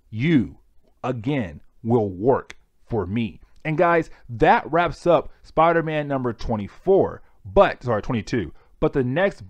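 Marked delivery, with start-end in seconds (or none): 0.1-3.4 lightly voiced villain, low and slow; a hint of a slow, low menacing growl; Green Goblin threat. Recapping the comic, voicing Goblin's threat to Peter, low and slow. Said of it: "turn into the Green Goblin and saying".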